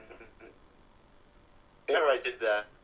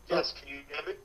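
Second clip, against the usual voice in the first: underwater; 3 semitones higher, faster than usual. Real, not acted. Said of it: "You, you sound like you're underwater".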